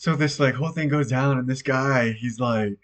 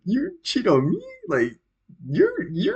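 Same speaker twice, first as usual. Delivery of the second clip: high-pitched, retold incredulous question; confused disbelief; 14 semitones higher, slower than usual. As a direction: act out stammering, baffled indignation. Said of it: "I was, like, so confused".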